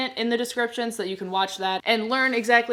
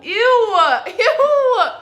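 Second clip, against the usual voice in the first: disgusted squeal; 12 semitones higher, 11 dB louder than usual. Real, not acted. Disgusted by texture.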